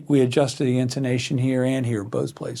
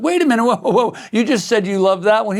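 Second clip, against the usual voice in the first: high, louder whoa-whoa disbelief; retold disbelief; 7 semitones higher, 4 dB louder, faster than usual. Builder reacting to a customer wanting another colour, high mock surprise. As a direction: pull up short in mock astonishment. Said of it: "And I was like".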